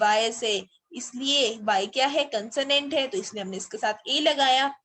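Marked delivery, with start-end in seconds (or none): none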